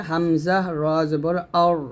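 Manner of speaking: অর with a thick, rounded final R; heavy Ra. Shown as a demonstration. Teacher shows students the full R in অর, heavy Ra. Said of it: "তখন র সাকিন মোটা হবে".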